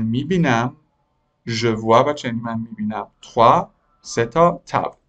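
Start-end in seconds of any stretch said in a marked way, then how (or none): none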